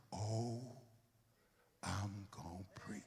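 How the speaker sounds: mock whisper. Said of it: "mocking a listener's thought".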